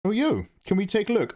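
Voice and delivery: high, eager mock ask; imitating a chatter. Streamer imitating a viewer asking to see the art, high and eager. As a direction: pitch it up like a keen viewer. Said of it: "quoting a chatter".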